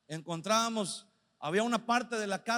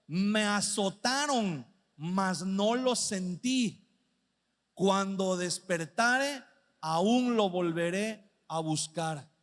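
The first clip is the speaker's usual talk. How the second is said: versículo muy lento y grave; lectura lenta; 3 semitones lower, much slower than usual. Acted. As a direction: deja caer cada frase despacio. Sigue leyendo el versículo. (predicador lee el versículo treinta y cinco, muy despacio)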